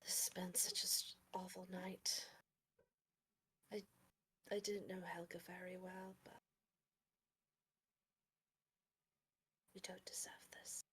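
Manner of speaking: soft, near-whispered condolence; hushed sympathy. Acted. Consoling after an awful night.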